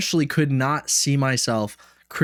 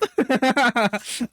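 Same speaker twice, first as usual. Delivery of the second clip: high chuckle at his own joke; laughing; 10 semitones higher than usual. Real, not acted. Joking about being an addict, laughing. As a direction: burst of amused laughter.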